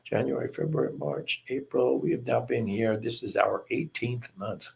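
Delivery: ordinary talk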